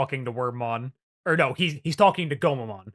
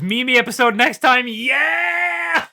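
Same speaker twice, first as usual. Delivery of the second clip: rising into a loud, high whoop; excited cheer; 10 semitones higher, 7 dB louder, slower than usual. Real, not acted. Host announcing a Mimi episode next time, excited cheer. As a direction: build to a drawn-out, jubilant yell.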